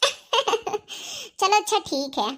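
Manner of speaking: slow, soft giggling reply; giggling goodbye. Acted. Wife giggles bye to her lover, slow and soft. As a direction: laugh it off softly and slowly.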